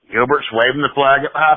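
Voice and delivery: ordinary talk, nothing marked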